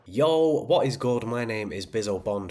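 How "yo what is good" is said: loud, higher hype shout-out; hype greeting. Real, not acted. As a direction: greet the crowd with energy.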